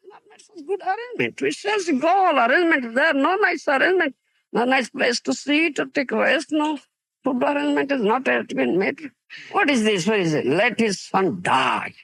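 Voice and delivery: high, indignant scolding of a careless host; angry outburst. Retold or imitated. Nityananda furious at Sivananda Sen's poor arrangements, angry high outcry. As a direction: raise the pitch high and rail at the bad arrangements. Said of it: "Then Nityananda got angry".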